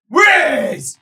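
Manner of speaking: high, loud ogre yell; ogre bellow. Acted. Ogre demands where the man went, bellowing. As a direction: bark the question. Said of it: "He goes".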